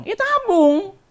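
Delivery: high, ringing command; loud emphatic answer. Real, not acted. Preacher answering what the congregation should do, loud emphatic answer.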